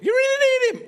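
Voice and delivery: put-on teenage voice crack. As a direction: voice cracks way up high and loud. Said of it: "I didn't know my voice cracked".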